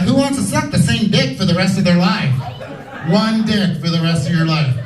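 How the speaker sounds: ordinary talk, nothing marked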